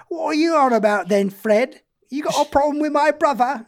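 high, loud Cockney challenge; drunken old accent. Acted. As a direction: pick a tipsy fight in broad Cockney. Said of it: "once they start drinking".

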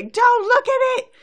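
high, loud mock-panicked shout; Twilight. Pony warning friends off the enchanted doll, high frantic shout. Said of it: "you hear Twilight say".

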